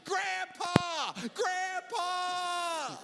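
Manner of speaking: high-pitched drawn-out cry for help; child yelling. Retold or imitated. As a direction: wail out for rescue like a panicked kid. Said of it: "he starts yelling".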